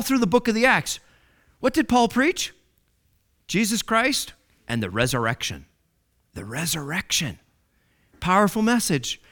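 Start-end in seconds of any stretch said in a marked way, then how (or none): none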